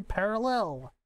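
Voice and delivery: "parallel" with the wrong syllable stressed, pitch lifted late in the word; misplaced stress. Pretend. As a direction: lean oddly on the end of "parallel". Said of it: "emphasizing the wrong syllable".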